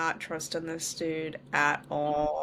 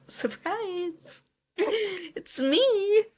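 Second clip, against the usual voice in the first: much higher, hammed-up villain cry; gleeful psycho reveal; 12 semitones higher, much slower than usual. Kieran unmasking himself to Emma, giddy high mock cry. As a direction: sing out the reveal like a giddy killer. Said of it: "and he just starts laughing, and he's like".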